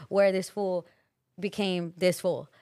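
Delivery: ordinary delivery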